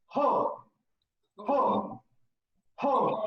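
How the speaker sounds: belly ho ho ho; belly laugh ho. Shown as a demonstration. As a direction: push each ho from the belly. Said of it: "you just say".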